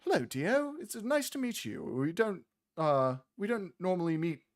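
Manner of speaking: high, friendly little gnome voice; chirpy gnomish woman. Acted. Gnome mother greeting the traveler Dio, high and friendly. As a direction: pitch way up to play the tiny gnome mother. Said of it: "across from you goes".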